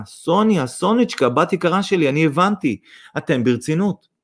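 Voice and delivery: high, overflowing emotion; tearful outburst. A narrator's reading. Mother embraces future daughter-in-law, tearfully overcome. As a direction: cry out tenderly, overcome. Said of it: "בפרץ רגשות חיבקה את סוניה ובכתה".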